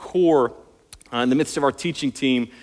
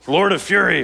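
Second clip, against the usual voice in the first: kung fu movie title; 4 semitones higher, 4 dB louder than usual. Pretend. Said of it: "Sounds like a bad kung fu movie".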